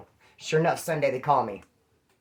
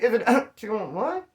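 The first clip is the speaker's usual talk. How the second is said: badly faked cracking voice, rising high; slow question climbing toward a failed crack; attempted voice crack; 6 semitones higher, slower than usual. Shown as a demonstration. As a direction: try to make your voice crack and miss. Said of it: "I can't even make my voice crack".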